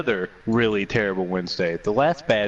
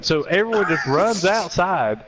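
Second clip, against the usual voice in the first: ordinary delivery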